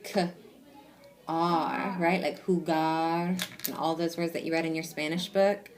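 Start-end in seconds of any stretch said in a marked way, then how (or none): 0.0-2.0 short "K", long pause, held "R"; letter sounds separated. Shown as a demonstration. Teacher breaking a word for pupil, separate sounds. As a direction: sound "K" and "R" apart, holding the R. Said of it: "Uh-huh, break it".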